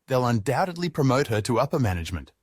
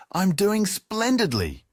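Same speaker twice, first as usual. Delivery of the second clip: high and slow, self-satisfied and grand; pretentious tone; 9 semitones higher, slower than usual. Acted. Julius answers Jasmine in a slow, high, pretentious tone. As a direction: drawl it out like a pompous snob. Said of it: "in his usual pretentious tone".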